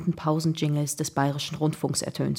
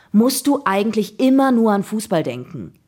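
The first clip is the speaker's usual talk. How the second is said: put-on high, loud, exasperated boy; annoyed; 4 semitones higher, 7 dB louder than usual. A narrator's reading. Jakob reproaching his football-mad friend, quite annoyed. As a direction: snap the question in irritation. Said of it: "fragte Jakob ziemlich genervt".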